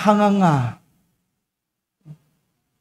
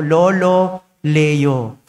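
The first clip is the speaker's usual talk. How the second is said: slow drawn-out call to the Pope, understated; planned shout; 5 semitones lower, much slower than usual. Retold or imitated. Priest recalling the shout he planned, mild drawn-out call. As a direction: stretch out the name like a planned call. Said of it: "Sabi ko, sisigaw ako".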